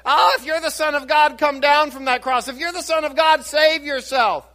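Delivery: a preacher voicing the crowd's scornful jeers; mocking taunt. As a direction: sneer it up at him like a mocking crowd. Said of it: "many mocked and many said".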